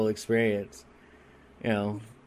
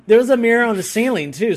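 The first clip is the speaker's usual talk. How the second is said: excited exclaim; 9 semitones higher, 7 dB louder than usual. Real, not acted. Excited, high, fast, laughing punchline.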